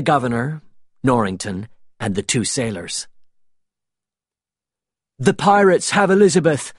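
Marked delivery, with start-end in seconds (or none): 5.2-6.7 raised, high-pitched cry of alarm; narrated shout, pitched well up. A narrator's reading. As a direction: call out the bad news urgently. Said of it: "he shouted".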